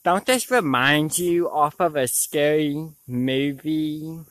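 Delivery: ordinary talk, nothing marked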